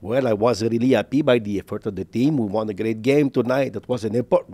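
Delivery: put-on English with a heavy Quebec French accent; mock post-game quote in thick Quebec-accented English. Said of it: "Moi, j'ai un accent".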